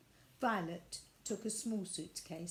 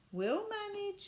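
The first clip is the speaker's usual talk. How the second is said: cheerful nanny; 12 semitones higher, 3 dB louder than usual. Acted. Miss Biscuit cheerfully insisting.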